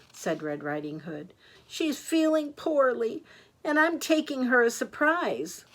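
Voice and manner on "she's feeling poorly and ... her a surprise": lightly voiced high little girl; a touch of girlish confiding, pitched up; higher, innocent storybook child.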